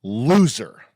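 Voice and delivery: LOSER isolated after a pause, drawn out and falling; weighty emphasis. Shown as a demonstration. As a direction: deliver LOSER as a slow verdict. Repeats the word for weight.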